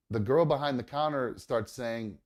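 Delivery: ordinary delivery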